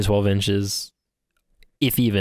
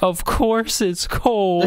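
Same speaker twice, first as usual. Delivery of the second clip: high, slow, tearful "of course"; crying retort; 12 semitones higher, much slower than usual. Retold or imitated. Woman who fell, answering through tears, imitated. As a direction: mimic a sobbing stranger snapping back, drawn out. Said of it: "tears going down her face".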